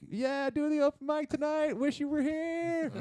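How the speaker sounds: very high falsetto gloating; fake-cheery falsetto brag; mock taunt. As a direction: tease in a squeaky, cheery put-on voice. Mock taunting social post.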